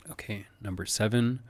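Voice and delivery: ordinary delivery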